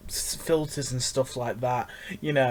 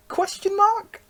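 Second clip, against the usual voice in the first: high squeak; 6 dB louder, slower than usual. Real, not acted. Mocking own confusion.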